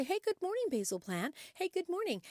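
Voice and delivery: high, singsong retold good morning; cheery affectionate greeting. Woman greeting her basil plant each day, sweetly cheery. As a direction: greet a pet plant brightly, pitch leaping up. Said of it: "speak these wonderful words to it".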